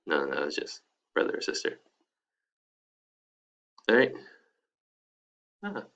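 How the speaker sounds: normal talking voice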